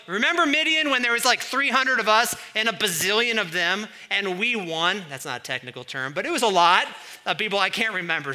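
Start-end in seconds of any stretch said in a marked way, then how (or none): 0.1-5.1 loud, high, quick burst of excitement; hammed-up, breakneck recollection of triumph; excited reminiscing. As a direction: crow about an impossible win. Voices Isaiah recalling an unlikely victory.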